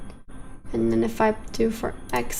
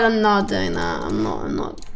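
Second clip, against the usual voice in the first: mock deep refusal. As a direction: drop the voice way down, mock-stern. Refusing a game choice.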